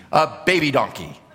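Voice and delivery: matter-of-fact reveal with a laugh; Jesus calmly presenting his humble mount; deadpan reveal. Retold or imitated. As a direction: drop the grand tone and just state it. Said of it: "And then Jesus says".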